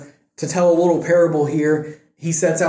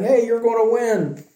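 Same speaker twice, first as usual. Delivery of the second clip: high, bright cheering call; mock cheerleader rallying the king; mock cheerleading; 7 semitones higher than usual. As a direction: cheer him on like a hammed-up pep squad. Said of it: "all cheerleading and saying".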